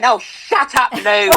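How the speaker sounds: a lightly voiced cranky old crone telling Lou off; cranky old woman. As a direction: play your grumpy elderly self, half laughing. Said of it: "really cranky and horrible by then".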